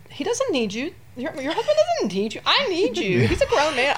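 high, loud, laughing plea; pleading with mom. Retold or imitated. New mother begging her mom not to go home, high and laughing. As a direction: beg your mom to stay, half laughing. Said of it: "and I was like".

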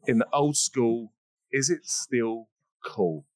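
slow, drawn-out show tagline; slow dramatic catchphrase; slow title tagline. Acted. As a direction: stretch the show's slogan out slowly. Show title repeated slowly.

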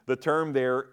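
ordinary delivery

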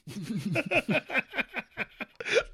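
long high giggle; high laugh. Real, not acted. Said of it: "I was dying right there".

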